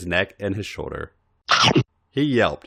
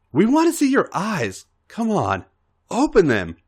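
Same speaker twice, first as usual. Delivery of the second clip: higher, eager urging; eager coaxing; 11 semitones higher than usual. Acted. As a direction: coax him eagerly. Alex coaxing.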